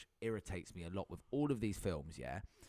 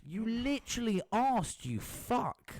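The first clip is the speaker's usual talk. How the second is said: exasperated retort; 10 semitones higher, much slower than usual. Real, not acted. Irritated retort in a podcast argument.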